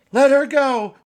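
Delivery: high, loud cry; desperate shout. Acted. Holly shouting at the witch to free Gail. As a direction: yell for her sister.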